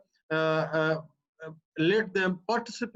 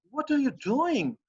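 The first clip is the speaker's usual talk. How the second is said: "what are you doing" high and slow, DOING swooping down; stunned challenge; 6 semitones higher than usual. Shown as a demonstration. Teacher trying to stop a person, high drawn-out challenge. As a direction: demand they stop, stretching "doing" on a high falling tune. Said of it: "he's trying to stop the person to stop doing it".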